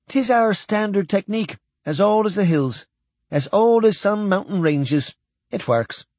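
high-pitched Scots-speaking alien; alien with Scottish accent. A narrator's reading.

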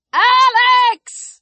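high, stretched cry of a name; panicked shout. A narrator's reading. Chris calls after fleeing Alex, shouting in panic. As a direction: call out in rising panic. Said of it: "Chris shouted, and now his panic was unmistakable".

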